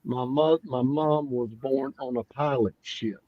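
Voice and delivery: words slurred with tongue held; holding tongue with fingers. Shown as a demonstration. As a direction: say it while pinching your tongue. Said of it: "hold your tongue and say".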